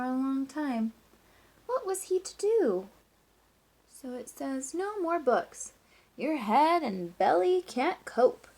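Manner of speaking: lively, sing-song storybook reading; reading to children. Acted. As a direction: read it like story time for a class. Teacher reads picture book aloud.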